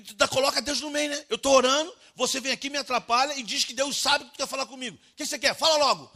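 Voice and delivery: loud, high-pitched angry tirade; irate father. Retold or imitated. Father scolding son for interrupting prayer, irate shouting. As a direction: yell at the child in full exasperation. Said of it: "eu tava irado com ele".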